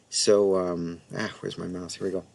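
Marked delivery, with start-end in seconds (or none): none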